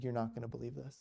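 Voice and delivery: soft, understated astonishment; hushed disbelief. Retold or imitated. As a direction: quietly confide it, as if stunned. Retold stunned priest, turned pale.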